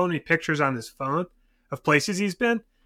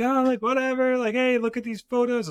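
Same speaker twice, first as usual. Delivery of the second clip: higher, breezy carefree tone; mock carefree; 9 semitones higher than usual. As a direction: lightly imitate a careless client showing off photos. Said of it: "so I'm like".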